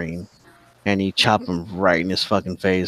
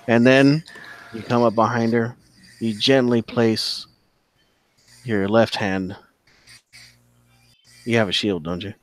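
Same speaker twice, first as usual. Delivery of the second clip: usual speaking voice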